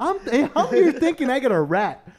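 high-pitched hurt disbelief; hammed-up indignation, voice way up; mock shock. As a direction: act astonished at a secret housemate. Plays shock at secret housemate.

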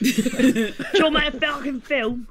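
high, slow laughing put-on voice; mock Ben Wheatley. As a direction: laugh through a silly bloke voice. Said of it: "he's like".

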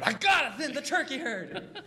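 high, slow, cracking Cage outburst; Nicolas Cage impression. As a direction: suddenly explode like Nicolas Cage. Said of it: "Terrible Nicolas Cage impression".

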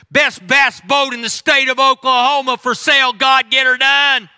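high, loud mock ad and plea; hammed-up sales pitch prayer. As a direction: bark the boat for sale at God.

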